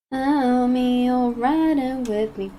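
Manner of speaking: ordinary talk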